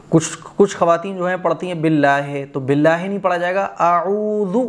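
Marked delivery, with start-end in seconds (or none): none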